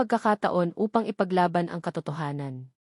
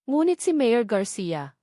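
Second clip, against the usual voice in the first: usual speaking voice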